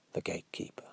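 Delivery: plain reading voice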